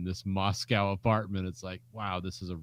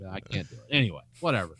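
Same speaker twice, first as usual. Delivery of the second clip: ordinary talk, nothing marked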